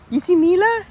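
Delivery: excited dog talk. Real, not acted. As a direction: call out to the dog in delight.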